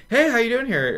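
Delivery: playing dumb. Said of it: "just like".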